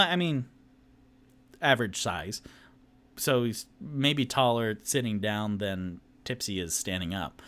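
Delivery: ordinary talk, nothing marked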